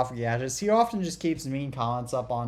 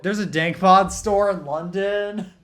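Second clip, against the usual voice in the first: laughing, louder and higher, in amused disbelief; laughing question; 6 semitones higher, 8 dB louder, slower than usual. Real, not acted. Friend laughs asking if there is a Dank Pods store, laughing loud.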